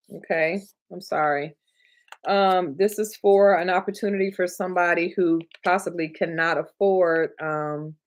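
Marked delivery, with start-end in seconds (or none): none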